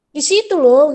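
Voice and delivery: loud pushy stress on loh; bossy emphasis. Retold or imitated. Mother imitating toddler ordering her around, loud bossy stress. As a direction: lean on it like a little boss. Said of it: "ada penekanan-penekanan yang kayak sok mengatur".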